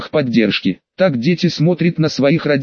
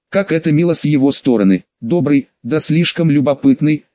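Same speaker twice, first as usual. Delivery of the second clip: ordinary delivery, nothing marked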